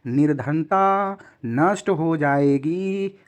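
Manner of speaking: very slow, drawn-out narration; slow storytelling translation. Shown as a demonstration. Teacher reading the Hindi translation to students, very slow. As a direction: lay the translation out word by word.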